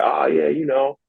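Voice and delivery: imitating a breezy shrug-it-off kid; put-on nonchalance. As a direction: act like winning never mattered. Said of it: "just super nonchalant and be like".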